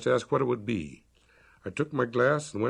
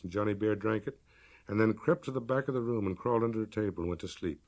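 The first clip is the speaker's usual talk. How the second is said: ordinary delivery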